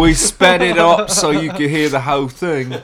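put-on loud, goofy drawling accent; Forrest Gump. Comic explains the sped-up bit, Forrest Gump accent. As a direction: put on a comic Southern-simpleton voice. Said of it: "You sound like Forrest Gump".

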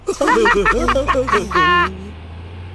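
high, loud giggle; children's snigger. A narrator's reading. Children watching truck take teacher, high sniggering. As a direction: snigger gleefully like schoolchildren. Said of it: "The children sniggered".

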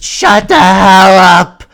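imitating a screaming teacher. A vlogger imitating a teacher screaming at the class. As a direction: yell it like a furious teacher. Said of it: "imagine coming to school".

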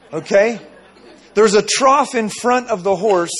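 usual speaking voice

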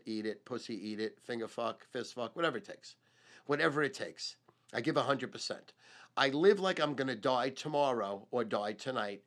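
normal talking voice

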